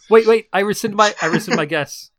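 sudden high loud backpedal; panicked. Real, not acted. Panicked retraction.

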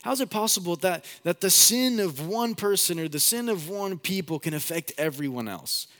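high incredulous challenge to the pastor; doubtful questioner. Retold or imitated. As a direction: sceptic asking how that could be possible. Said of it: "some people hear that and they go".

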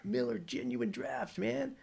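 hammed-up American accent, high and slow; mock American beer-commercial accent; put-on American accent. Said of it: "I need to put on an American accent".

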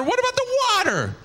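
high-pitched incredulous demand; squeaky, exasperated question; baffled insistence. Retold or imitated. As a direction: demand it in comic disbelief. Baffled pastor insisting on answer.

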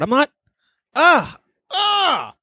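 ordinary delivery

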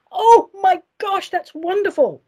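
high, gushing delight; thrilled, high-pitched gasp of gratitude. Real, not acted. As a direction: gush over a surprise gift, drawing out the opening. Delighted reaction to gift letter.

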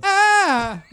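sudden piercing high shriek; annoying shriek. Acted. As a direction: let out a grating squeal. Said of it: "that's how you get the most annoying noise".

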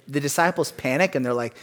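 ordinary talk

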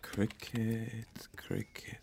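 quietly turning the word over; trying the word softly, fading to a whisper; murmured practice of a new word; muttered to himself. Real, not acted. Practising the strange English word.

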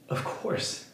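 breathy whispered of course. Retold or imitated. Condemned man to Corrie, a whispered yes. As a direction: barely voice it, longing.

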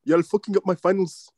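high mock Greek accent; Giannis's strong accent. Imitating the player yelling at his team, extra-strong accent. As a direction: scold in a put-on thick Greek accent. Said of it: "his accent is extra strong".

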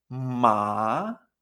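"Ma" low, then rising; third tone. Shown as a demonstration. Teacher summing up the third tone, dipping "Ma". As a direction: dip on "Ma" and climb back. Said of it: "Tercer tono".

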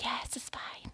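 hushed reply. Retold or imitated. Client whispering back to the masseuse at the door. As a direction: answer through the door under your breath. Answering masseuse's knock, lying undressed.

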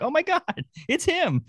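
high-pitched squeal of discovery; excited recognition. Retold or imitated. Speaker retells spotting the actor by his voice, excited recognition.

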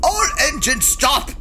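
put-on barked command in a German accent; shouted order. Captain shouting at the ship's computer, barked order. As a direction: snap the engines to a halt. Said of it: "you needn't shout".

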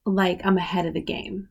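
normal talking voice

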